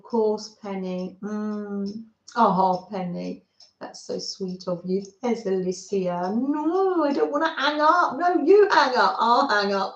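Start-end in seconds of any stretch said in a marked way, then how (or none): 6.3-9.9 higher, giggly lovers' phone-call banter; giggly late night call. Pretend. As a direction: tease like neither wants to hang up. Playful phone-call goodbye routine.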